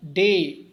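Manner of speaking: "डे" punched loud and held long; stress on डे. Shown as a demonstration. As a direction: hit "डे" hard as the stressed syllable. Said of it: "stress sound है". ("डे" पर तेज़ ज़ोर)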